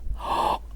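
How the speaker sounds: breathy gasp of fake surprise; mock gasp. A narrator's reading. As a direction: gasp as if just remembering. Stranger feigns surprise.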